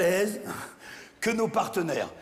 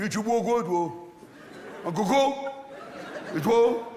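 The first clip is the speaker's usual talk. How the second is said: put-on café order in a broad Champagne accent; 2 semitones higher than usual. Locals ordering drinks back home, Champagne accent. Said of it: "on parle comme ça chez moi".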